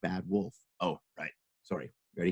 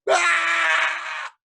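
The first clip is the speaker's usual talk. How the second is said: high shrill pig scream. Acted. Little pig screaming as the house flies away. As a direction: scream as you are blown away.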